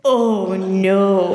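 put-on low, drawn-out babyish sympathy; baby talk. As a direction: coo it in mock concern. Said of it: "Baby talk".

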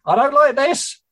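high, loud, scared cry over the noise; frightened shout. Acted. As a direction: yell it over the racket in fear. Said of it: "she shouted over the racket".

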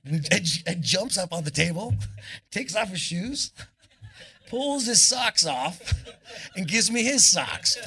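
high, rising excitement building to a shout; excited storytelling. Real, not acted. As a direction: build it up to the big payoff.

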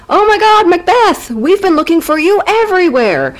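high-pitched, breathless-fan style greeting; gushing excitement. Acted. Buddies finding Macbeth on the road, high excited gush. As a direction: squeal it with delighted relief.

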